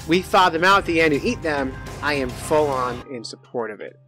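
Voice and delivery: ordinary delivery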